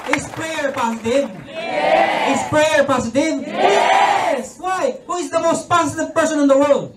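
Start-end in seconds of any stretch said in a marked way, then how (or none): 0.1-5.0 higher, shouted preacher call; call and response. Real, not acted. Preacher firing questions at the audience, higher shout. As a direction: rouse the crowd to answer back.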